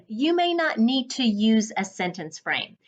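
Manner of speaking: ordinary delivery, nothing marked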